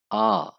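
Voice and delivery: आ, long open aa; German A as aa. Shown as a demonstration. Teaching the German alphabet, calling out the letter clearly. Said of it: "आ बोलेंगे".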